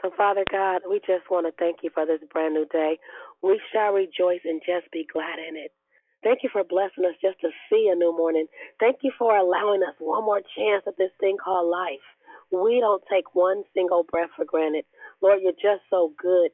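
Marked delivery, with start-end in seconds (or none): none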